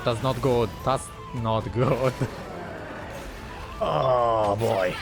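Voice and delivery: ordinary talk, nothing marked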